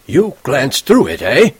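put-on slow, sarcastic old man's drawl; mock the boy's excuse, slowly. A narrator's reading. Teacher mocking Holden's excuse, sarcastic. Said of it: "he said, very sarcastic".

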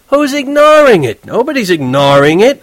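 worked up. Acted. Horwitz getting riled up while driving. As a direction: fire it back, all riled up. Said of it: "He got so damn excited".

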